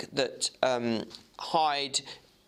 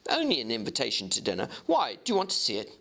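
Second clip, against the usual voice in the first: fast, offhand lawyer; lawyer Utterson; much faster than usual. Acted. Utterson brushing off his clerk's question, quick and offhand. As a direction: rattle it off to seem casual.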